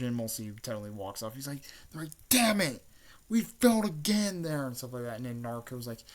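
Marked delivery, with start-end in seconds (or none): 2.3-4.7 high, loud mock-anguished cursing; acted frustrated outburst. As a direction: burst out in cartoon frustration. Characters curse their failure.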